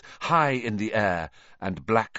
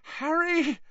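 very high frightened whimper; thin, high girl's plea. A narrator's reading. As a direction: plead with him, whimpering. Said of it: "Hermione whimpered".

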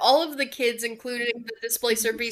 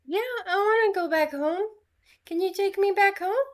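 high, fast kitten voice; a small creature pleading; 8 semitones higher, faster than usual. Acted. Kitten creature asks to go home.

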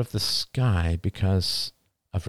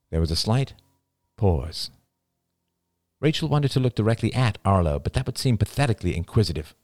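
ordinary delivery, nothing marked

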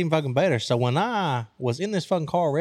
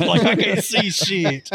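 laughing protest; 4 semitones higher, 4 dB louder, slower than usual. Retold or imitated. Arguing with cop about flashlight.

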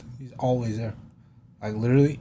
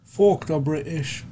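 slow, raised British caricature; mock British accent; 4 semitones higher, much slower than usual. Jeering at the British, mock British accent. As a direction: jeer in a drawn-out British accent.

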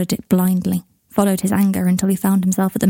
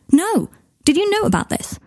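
much higher, heated demand; angry outburst; 11 semitones higher than usual. Acted. Harry refusing to sit, angrily confronting the minister. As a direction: snap back in outrage.

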